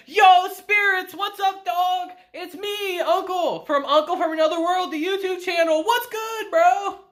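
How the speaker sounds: high, loose hype-guy greeting; like greeting your buddies on a stream; casual bro. Retold or imitated. Uncle calls up the spirits.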